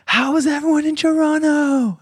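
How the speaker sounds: high, slow stadium-host call of "How is everyone in Toronto?"; mock arena frontman. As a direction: ham it up like a touring star greeting the wrong city. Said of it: "they're like".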